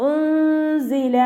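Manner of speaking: ഉൻസില with the nun hidden in a nasal hum, slow and high; ഉൻസില, nun concealed, z buzzing clear. Shown as a demonstration. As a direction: glide ഉൻസില through the nose into a clear z. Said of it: "സായുടെ സൗണ്ടിനെ വ്യക്തമായിട്ട്".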